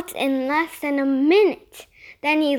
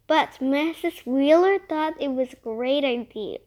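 usual speaking voice

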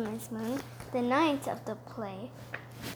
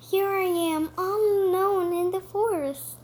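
cartoon princess; 7 semitones higher, 4 dB louder than usual. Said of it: "said the pretty princess".

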